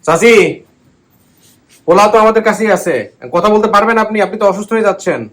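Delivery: loud and high, every word clear; to someone hard of hearing. Real, not acted. খান শোনেন কম.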